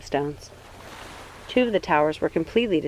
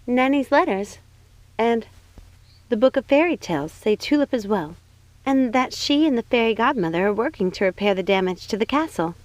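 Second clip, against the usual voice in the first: a higher, bright-eyed princess voice; 4 semitones higher than usual. Acted.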